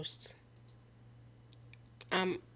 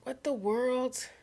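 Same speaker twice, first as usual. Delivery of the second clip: baffled; 6 semitones higher, slower than usual. Real, not acted. Baffled at the text.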